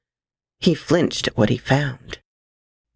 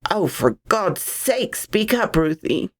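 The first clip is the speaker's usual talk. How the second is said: demanding; 3 semitones higher than usual. Acted. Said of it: "Sam demanded".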